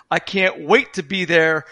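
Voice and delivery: usual speaking voice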